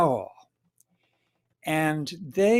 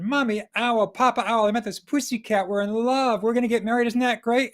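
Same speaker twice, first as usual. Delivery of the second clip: put-on excited young owl; 4 semitones higher, faster than usual. Owl tells parents about love.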